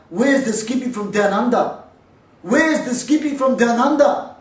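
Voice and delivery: hammed-up taunting shout; imitating a yell. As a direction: call out like a taunting fighter. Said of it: "I could hear him yelling out".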